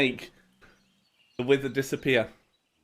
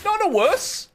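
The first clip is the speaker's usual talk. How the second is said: panicked. Real, not acted. Panicked gamer in danger.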